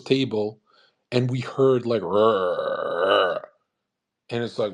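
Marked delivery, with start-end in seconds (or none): 2.0-3.4 low, drawn-out "rrr" growl; imitated stomach growl. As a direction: mimic a loudly rumbling hungry belly. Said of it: "growling 'cause he's hungry".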